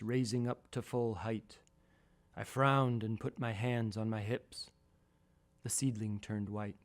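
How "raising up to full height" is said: ordinary delivery, nothing marked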